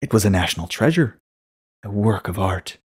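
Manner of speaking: ordinary delivery, nothing marked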